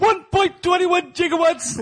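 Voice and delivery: high, slow excited movie quote; Doc Brown line. Retold or imitated. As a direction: squeak it out slowly like a frantic scientist. Quoting Back to the Future line.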